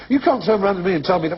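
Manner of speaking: high-pitched, indignant mock protest; mock arguing. Quoting what people say in arguments, mock indignation. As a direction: imitate someone protesting in a row. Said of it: "when people have arguments".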